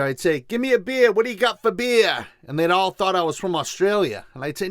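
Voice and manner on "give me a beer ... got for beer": put-on loud, high Boston accent bar order. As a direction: call to the bartender in thick Boston accent. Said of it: "I typically have a thick Boston accent".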